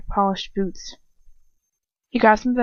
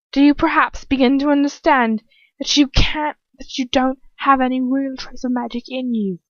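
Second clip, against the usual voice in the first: high, raised scornful question; acted condescending jeer, pitched up; mocking taunt; 5 semitones higher, 4 dB louder than usual. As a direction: taunt them as if explaining to a failure. Attacker mocks victim's lack of magic.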